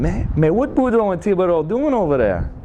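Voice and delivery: high, Cajun townsfolk voice; Cajun accent. Pretend. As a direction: wonder aloud in Cajun English. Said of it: "they were wondering".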